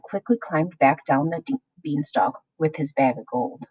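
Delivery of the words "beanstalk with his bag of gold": plain reading voice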